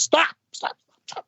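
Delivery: mock high-pitched command to halt; Frank. Bandleader halting the band in rehearsal, mock high-pitched command. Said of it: "then Frank goes".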